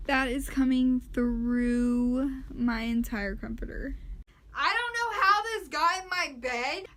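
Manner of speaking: loud, slow startled outcry; shouting over wind. Real, not acted. Shocked at bug in bed.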